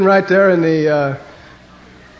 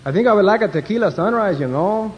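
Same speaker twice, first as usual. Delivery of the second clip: put-on Mexican accent, pitched higher; 5 semitones higher than usual. Mexican passenger ordering from stewardess, mock Mexican accent. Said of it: "And the one Mexican said".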